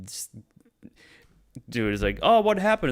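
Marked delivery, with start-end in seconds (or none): 2.2-2.9 high, loud, startled question; mock surprise. Dude asking the panicked group what happened, mock surprise. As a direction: pop up high like a startled bystander. Stranger reacts in retold scene.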